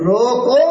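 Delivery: "روکو" drawn out alone with a rise; word set apart. Shown as a demonstration. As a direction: cut "روکو" off from what follows. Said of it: "الگ کر دو".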